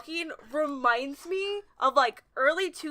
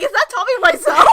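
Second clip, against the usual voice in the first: excited recognition; 7 semitones higher, 12 dB louder than usual. Retold or imitated. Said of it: "I was like".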